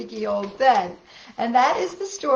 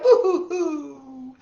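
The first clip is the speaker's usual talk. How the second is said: slow laughing boo-hoo; mock crying; 5 semitones higher, 4 dB louder, slower than usual. Reading to children, the boy's hammed-up crying. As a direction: drag out a pretend sob. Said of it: "the little boy cried".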